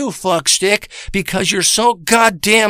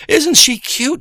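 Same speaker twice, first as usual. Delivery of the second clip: sarcastic cooing; 8 semitones higher, slower than usual. Pretend. Sarcastic about the woman.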